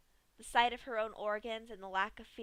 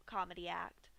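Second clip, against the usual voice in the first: usual speaking voice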